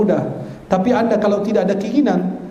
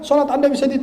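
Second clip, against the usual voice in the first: normal talking voice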